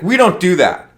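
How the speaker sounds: stern correction. Retold or imitated. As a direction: lay down the rule to a newbie. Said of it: "he was like".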